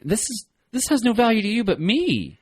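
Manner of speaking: high, pleading cartoon character voice; Jerry. Jerry begging a dog to spare his check, mock pleading. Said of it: "and he's like".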